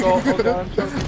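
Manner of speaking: high, teasing mock panic; mocking echo. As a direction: parrot it back to tease. Teammate mockingly echoes the complaint.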